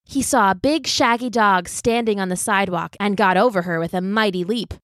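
ordinary delivery

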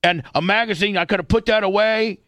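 put-on old timer lecture. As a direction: lecture like a grumpy old man. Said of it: "starts getting into the old timer shit".

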